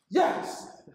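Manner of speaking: laughing victory yell; triumphant whoop. Acted. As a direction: celebrate the touchdown with a laugh. Scores a touchdown.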